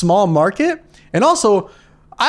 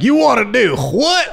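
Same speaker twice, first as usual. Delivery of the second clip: high, slow, incredulous dad; drawn-out mock outrage, higher pitch; dad with an accent; 7 semitones higher, slower than usual. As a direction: put on an accent as a disbelieving father. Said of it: "I don't know why he has that accent".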